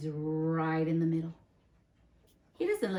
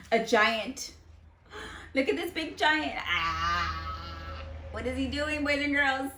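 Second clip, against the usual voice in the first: higher, louder excited wonder; mock excitement; 6 semitones higher, 4 dB louder than usual. Teacher pointing out the giant to the kids, excited. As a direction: gasp it out in wonder.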